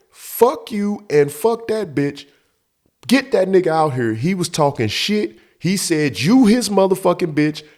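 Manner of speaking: high, heated retort; acting out an angry confrontation; angry comeback. Acted quarrel at the door.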